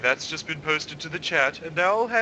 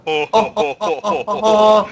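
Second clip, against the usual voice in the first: building mad cackle, louder and higher; faking madness; 7 semitones higher, 11 dB louder than usual. Pretend. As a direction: cackle like a lunatic, ever louder. Joins mock maniacal laughter.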